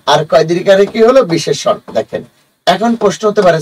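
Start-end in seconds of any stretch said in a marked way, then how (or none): none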